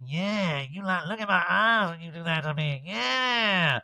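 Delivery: high, drawn-out "yeah"; mock lustful. Man eyeing his partner as he pleasures her, mock lustful. Acted bedroom boast, retold.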